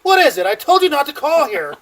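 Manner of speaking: high, loud, annoyed woman on the phone; irritated ex. Retold or imitated. Megan telling the drunk caller off, high and irritated. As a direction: scold an unwanted caller.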